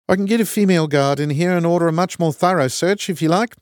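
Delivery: put-on broad kiwi accent, firm; stern kiwi prison guard; New Zealand accent. A narrator's reading. Guard threatens search.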